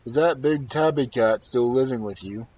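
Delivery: loud, far-off troll voice; troll calling from afar. Acted. Troll calls out to Halvor through the woods. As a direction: boom it across the trees.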